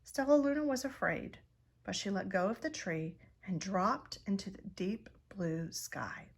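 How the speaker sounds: plain reading voice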